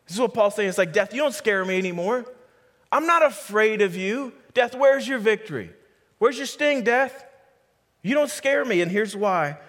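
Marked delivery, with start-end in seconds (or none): 0.9-4.3 high, bold challenge to death; defiant taunt. Acted. Preacher voicing Paul facing down death, defiant. 4.5-7.2 high, louder mocking challenge; defiant taunt. Preacher voicing Paul taunting beaten death, defiant. 8.0-8.8 high, bold challenge to death; defiant taunt. Acted. Preacher voicing Paul facing down death, defiant.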